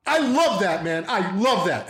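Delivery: high, emphatic hammed-up praise; happy losing it. Dad raving at his kid's good deed, elated outburst. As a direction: gush with the force of a telling-off. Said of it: "match the energy of getting mad, but I'm happy".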